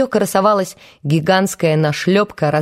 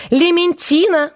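shrieking; 12 semitones higher than usual. A narrator's reading. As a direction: shriek the dog's name at full pitch. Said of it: "верещала Белла".

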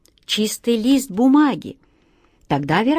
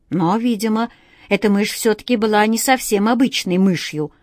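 ordinary delivery

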